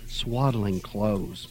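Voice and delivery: very slow, soft, sighed correction; weary sigh. A narrator's reading. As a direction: correct them with tired patience. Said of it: "Mother sighed".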